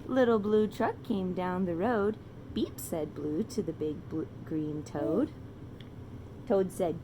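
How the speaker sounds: lilting, slow picture-book reading; reading to children. A narrator's reading. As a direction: read it like a bedtime story to a toddler.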